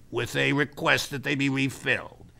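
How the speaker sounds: ordinary talk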